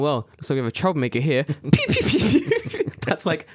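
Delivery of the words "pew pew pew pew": very high piping "pew pew"; high, quick repeated pews; imitated pew-pew noise. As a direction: mimic a high beeping alarm. Imitated noise in cop sketch.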